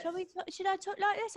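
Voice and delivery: high, soft put-on accent; Jake Maverick. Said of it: "You sound like Jake Maverick".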